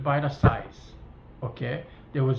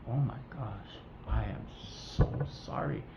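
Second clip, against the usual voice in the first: imitating a teary, softened apology; retold apology, hushed and halting; tearful apology; 4 semitones lower, 7 dB quieter than usual. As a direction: quietly voice her stricken sympathy, mildly choked up. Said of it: "she welled up and she had tears in her eyes".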